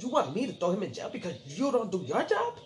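high, indignant retold rebuke; angry judge. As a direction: throw it back at the lawyer, outraged. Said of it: "she was tight".

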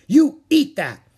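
very high, loud, drawn-out command; shouted order. A narrator's reading. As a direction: insist at full volume, stretching the words. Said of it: "she repeated".

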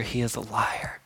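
hushed unvoiced whisper. Pastor reading First John to the church, whispered. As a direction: drop to a whisper on the verdict. Quoting scripture dramatically.

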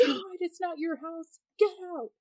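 high, exasperated mock yell; imitating husband screaming. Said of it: "he just starts screaming".

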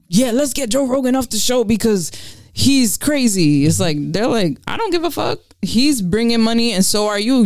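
high, mocking corporate voice; dismissive mock executive; Spotify exec voice. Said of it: "they're not just gonna be like".

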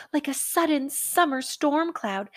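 usual speaking voice

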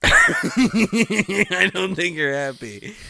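high, loud, slow words through laughter; laughing. Real, not acted. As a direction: laugh your way through the line. Cracking up in the role.

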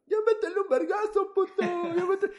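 high-pitched egging-on yell; shouting. Retold or imitated. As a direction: yell it like a rowdy teen crowd. Retold video of kids egging on fight.

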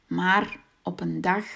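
plain reading voice

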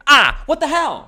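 high, loud startled exclamation of younger self; hammed-up yelp of surprise; mock outburst. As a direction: blurt it out like a kid caught off guard. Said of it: "caught me saying hell".

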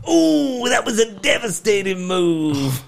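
high, loud mock awe; over-dramatic commentator. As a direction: hype a tiny move as a catastrophe. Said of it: "it's just a tap and".

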